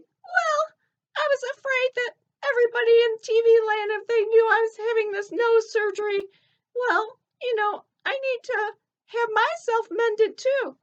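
high, squeaky cartoon elephant. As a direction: chirp it like a little elephant puppet. Ellie speaks of her fears.

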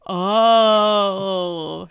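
a loud sly oh; loud knowing oh. Retold or imitated. Eric back at the door, a loud drawn-out oh. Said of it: "Eric standing there going".

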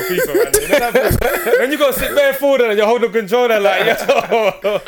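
laughing through the words; can't stop laughing while telling it. Real, not acted.

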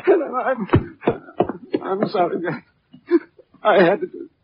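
tearful apology; crying. Acted. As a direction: choke the words through tears. After killing, crying.

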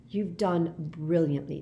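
ordinary delivery

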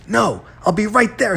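high-pitched, agitated refusal; urgent insistence. A narrator's reading. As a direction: cut off any protest urgently. Agitated caller cutting off a protest.

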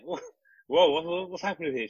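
high, startled "whoa, what's happening" rising up; retold disbelief. Telling friends about the ward, high startled disbelief. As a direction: replay your shock at the screaming next bed. Retold shock at screaming neighbour.

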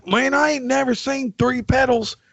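high and slow, mocking the guy who never saw three pedals; mocking bandleader voice. Bandleader mocked in retold story.

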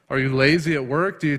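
ordinary talk, nothing marked

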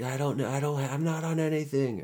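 high, put-on protesting; mock frantic denial. Suspect interrogated at the hospital, mock frantic denial. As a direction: protest innocence in a hammed-up high voice. Denies drugs under interrogation.

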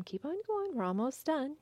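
high, singsong cheering-on; encouraging parent. Acted. Parent to kids cleaning baseboards, encouraging. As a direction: cheer little helpers along in a high voice. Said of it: "you just encourage them".

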